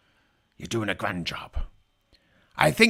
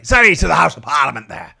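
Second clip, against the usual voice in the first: loud apology; 2 semitones higher, 11 dB louder than usual. Acted.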